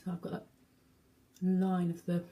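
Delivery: talking with cheeks sucked in. Real, not acted. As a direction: speak with hollowed, sucked in cheeks. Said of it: "I'm just sucking my cheeks".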